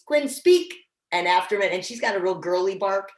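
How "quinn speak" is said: higher, louder call; "Quinn, speak." louder, rising on "speak"; firmer dog command. Shown as a demonstration. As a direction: repeat the command with more push. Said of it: "And then I wait".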